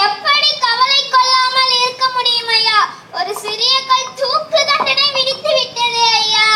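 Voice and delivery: high, whiny voice of a frightened gardener; a gardener pleading his innocence, high and whiny; panicky gardener. Acted. Gardener laments to Birbal.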